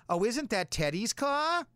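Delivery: high, slow, gossipy busybody voice; mock a nosy neighbour spotting the car. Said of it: "some busybody's gonna drive by and say".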